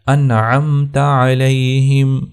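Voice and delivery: slow melodic tilawat; slow, chanted Quran recitation. Acted. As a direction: recite slowly with drawn-out vowels.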